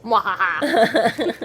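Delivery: slow villain cackle; stretched-out evil laugh; sneering panto villain laugh. Acted.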